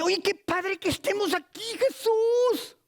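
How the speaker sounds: high, overexcited squeal of delight; Peter gushing. Acted. Peter blurts to Jesus on the mountain, giddy and high-pitched. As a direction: blurt it high, dragging out the last word. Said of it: "Y dice".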